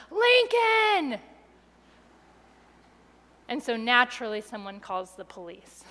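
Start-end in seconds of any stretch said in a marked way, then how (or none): 0.1-1.2 high, loud retold scream of the name. Husband runs down the sidewalk screaming for his son. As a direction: belt the name out like a panicked dad. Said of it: "screaming at the top of his lungs".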